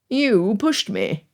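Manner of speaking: slow, haughty accusation. A narrator's reading. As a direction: look down your nose. The prince accuses Elizabeth.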